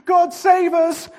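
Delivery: very high, loud desperate plea; frantic cry. A narrator's reading. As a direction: shriek it over the storm. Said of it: "cried another".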